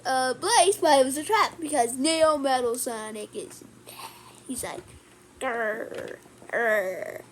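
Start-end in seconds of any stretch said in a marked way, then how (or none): 5.4-7.2 much lower and softer, growling robot; a deep, quiet mechanical villain growl. Retold or imitated. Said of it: "This is my robot voice".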